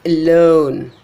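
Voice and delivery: alone as uh-LONE, LONE loud and long; second-syllable stress. Shown as a demonstration. Teacher showing unstressed prefix, alone with loud LONE. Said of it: "but not the prefix".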